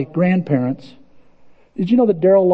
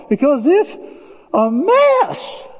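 mock-exasperated high yelp; exasperated squeak; 9 semitones higher, much slower than usual. Real, not acted. Pastor to the congregation about the world, squeaky and exasperated. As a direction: pitch jumps way up on a mess.

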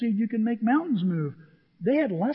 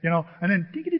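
ordinary talk, nothing marked

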